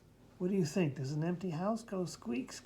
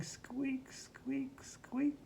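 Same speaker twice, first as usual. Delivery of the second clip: higher, softer squeaks, teasing; squeaky voice; 6 semitones higher, 7 dB quieter, much slower than usual. Acted. Asking the child whether a big horse squeaks, in higher soft squeaks. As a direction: squeak it small and high. Silly guess read to child.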